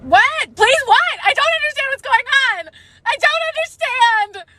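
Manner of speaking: very high, loud frantic shouting; desperate scream. Acted. Character's desperate outburst.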